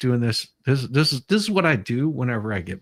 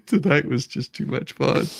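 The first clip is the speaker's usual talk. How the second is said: laughing; 3 semitones higher than usual. Real, not acted. Laughing after a fun stream.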